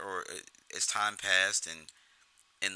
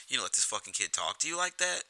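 higher, mock-outraged ribbing; mock Jimmy ribbing; 5 semitones higher than usual. Jimmy turning to Tommy, mock-outraged ribbing. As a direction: feign outrage to wind Tommy up. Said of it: "he turns to Tommy".

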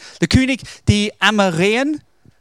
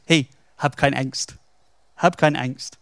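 lower, unruffled reassurance; calm reassurance; 4 semitones lower than usual. Retold or imitated. Elias to his panicking servant, calm and unworried. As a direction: play the unbothered master soothing someone.

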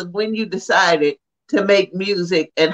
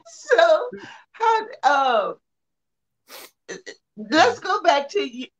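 laughing; 9 semitones higher, slower than usual. Real, not acted. Interviewer laughing into question.

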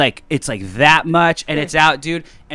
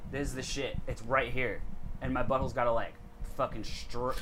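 usual speaking voice

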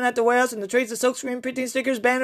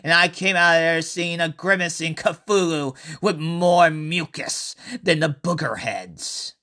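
story narration; 4 semitones lower than usual. A narrator's reading. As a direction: tell the tale unhurried and low. Reads his horror story aloud.

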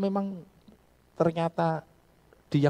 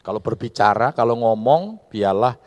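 louder, firm exhortation; loud pulpit emphasis; 11 dB louder than usual. Acted. Pastor urging speech grounded in scripture, loudly. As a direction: hammer the point home.

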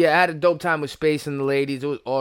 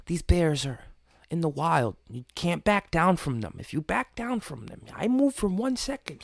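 imitating Grizzly Man; 9 dB quieter than usual. Imitating Grizzly Man's calm talk.

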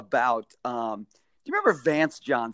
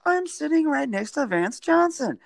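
starstruck; 12 semitones higher than usual. Retold or imitated. Starstruck inner thought.

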